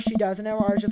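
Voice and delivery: normal talking voice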